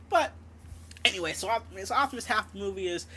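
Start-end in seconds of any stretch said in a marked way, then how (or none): none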